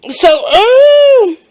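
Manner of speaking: high sharp yelp at the sourness; sour yelp. Real, not acted. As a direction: cry out as the sour hits. Sour candy hits hard.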